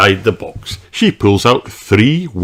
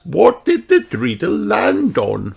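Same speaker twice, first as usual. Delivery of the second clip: Granny Pig voice; 12 semitones higher than usual. Acted. Granny Pig calls Peppa to look, high voice. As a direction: call the child over, grandmotherly.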